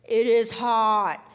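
loud, slow, drawn-out groan of heat; exaggerated heat complaint. Preacher to congregation, loud slow mock complaint about the heat. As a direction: moan it like the heat is crushing you.

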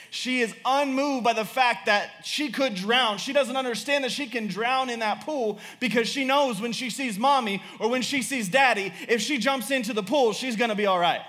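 high-pitched, driving sermon delivery; impassioned preaching. Real, not acted. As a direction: preach it urgently, pressing each point home.

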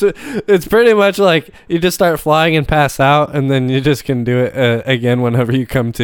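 ordinary talk, nothing marked

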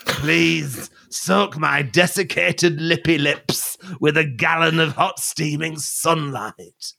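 hammy high desperate plea; dry man voice. Acted. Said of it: "I'll be the dry man".

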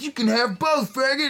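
high mocking rocker voice. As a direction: toss it off like a cocky rocker. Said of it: "[name] is like".

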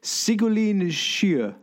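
slow, high, hammed-up French accent on the name; mock French accent. Host jokingly tries the guest's name in a mock French accent. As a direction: stretch the name out in a playful fake French accent. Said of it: "but I'll do it with a French accent".

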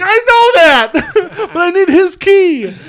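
very high, loud, drawn-out shout; hammed-up hollering through laughter; exasperated mock yell. Acted robber yelling back.

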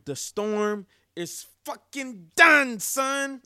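drawn-out celebratory yell; triumphant shout. Real, not acted. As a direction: roar it like the drought just ended. Celebrating the new quarterback.

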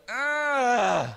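put-on high, loud groan of a sleepy child; groggy groan. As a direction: groan like a kid who won't wake up. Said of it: "She doesn't do anything but go".

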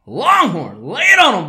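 loud high hand-off; hype shout. Acted. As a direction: call it out like a show host. Handing off to co-host.

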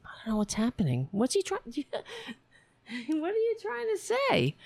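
very high soft cooing at the cat. Real, not acted.

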